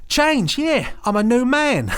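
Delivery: high, loud hammed-up Cockney; Michael Caine Cockney. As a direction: belt it out in a mock Cockney accent. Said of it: "his Cockney voice".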